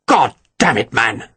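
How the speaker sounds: understated exasperated outburst; mildly exploding, each word punched out; exploding in anger. A narrator's reading. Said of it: "Picton exploded".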